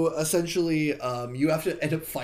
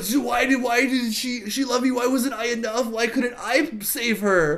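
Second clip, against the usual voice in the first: mock lament; 7 semitones higher than usual. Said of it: "He's like".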